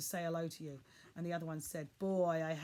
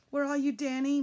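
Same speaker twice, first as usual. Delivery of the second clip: loud, higher call; 7 semitones higher, 9 dB louder than usual. A narrator's reading. Father calling to his son in the dark, loudly. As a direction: call to him across the wood. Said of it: "my father called out".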